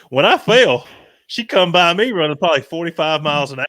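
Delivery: loud, high imitation of a rattled buddy; worked-up retelling. Fallen buddy reports the girl speeding past, worked-up. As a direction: blurt it high and loud, mimicking him.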